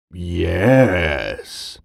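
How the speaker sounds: low, soft growl. Acted. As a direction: growl it like a big bear. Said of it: "Bear growled".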